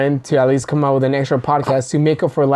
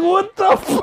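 high, startled cry of disgust; startled yelp; slower than usual. Retold or imitated. Startled at the beetle on his shoulder, a high shocked squeal. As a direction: squeal it like a bug just landed on you. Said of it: "I'm like".

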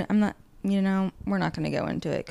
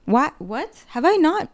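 sudden realization; 8 semitones higher, 7 dB louder, slower than usual. Retold or imitated. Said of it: "it hits me that, like".

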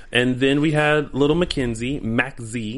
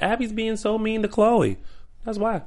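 mocking whine; 11 semitones higher than usual. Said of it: "all those people who are like".

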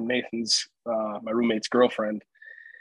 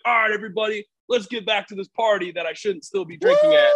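high, hollered pretend rallying call; triumphant mock announcement, pitched up; mock party announcement; 7 semitones higher, 3 dB louder than usual. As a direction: play rallying the whole party.

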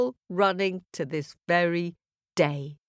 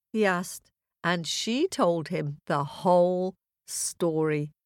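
plain reading voice

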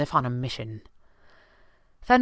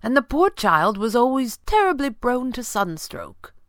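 higher, slightly awkward butler voice; 8 semitones higher, 4 dB louder than usual. A narrator's reading. Butler explaining himself to his employer, suddenly awkward. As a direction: explain yourself with stiff politeness. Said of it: "suddenly awkward".

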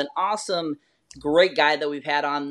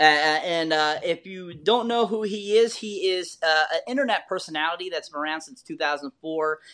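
ordinary talk, nothing marked

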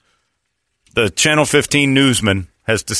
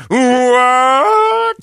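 high, louder drawn what; shouted mock what; 8 dB louder than usual. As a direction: yell the anchor's fake shock. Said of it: "He said".

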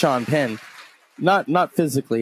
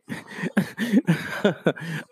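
laugh; 4 semitones higher, 5 dB quieter than usual. Real, not acted. Laughs at [name] complaint.

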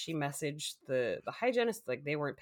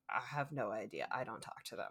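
put-on dismissive girl; 7 semitones lower, 9 dB quieter than usual. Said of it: "this girl was just like".

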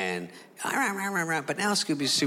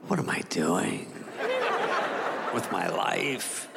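mopey dog; 5 semitones lower than usual. Retold or imitated. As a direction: sigh it out like a dog regretting his life. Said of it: "and he's like".

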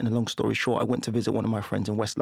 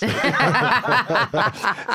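loud high laugh; 11 semitones higher, 6 dB louder than usual. Real, not acted. Laugh after confession.

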